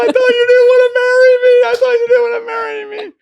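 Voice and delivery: high, loud, fast crying shout; crying and cursing. Retold or imitated. Fiancée at the proposal, loud mock crying shout. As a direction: sob and yell it at him at once. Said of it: "she just broke down crying".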